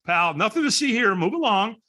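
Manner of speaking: high-pitched, hurried-off reassurance; fake all-fine. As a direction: pretend you're fine and wave them away. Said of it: "when we're not okay, what do we say?".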